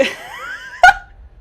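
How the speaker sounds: sudden high-pitched laugh; loud burst of laughter. Real, not acted. Streamer laughing while asking viewers, high laugh.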